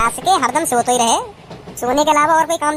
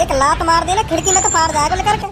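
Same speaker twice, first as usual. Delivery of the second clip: ordinary delivery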